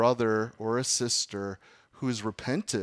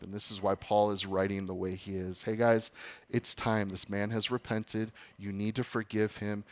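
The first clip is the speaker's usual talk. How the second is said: normal talking voice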